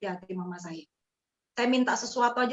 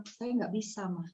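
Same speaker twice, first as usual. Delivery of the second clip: softer, hesitant reply; quiet answer; 8 dB quieter than usual. Retold or imitated. Daughter answers her weeping mother, softly hesitant. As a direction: answer gently, almost afraid. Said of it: "Saya bilang".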